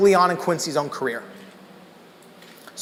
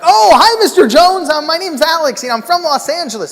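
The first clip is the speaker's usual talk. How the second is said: much higher, louder star-struck hello; eager greeting; 10 semitones higher, 7 dB louder than usual. Retold or imitated. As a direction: gush at meeting your idol, voice way up. Eager greeting to [name].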